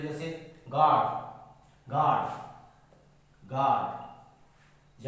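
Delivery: गार्ड stretched very slowly, long "aa"; teacher drawing out the vowel; model word guard. Shown as a demonstration. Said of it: "आप देख सकते हो".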